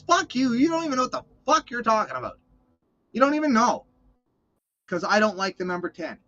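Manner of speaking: high, loud ranting tirade; angry rant. Real, not acted. Ranting at a critic who mocked his hatred of ten, angry.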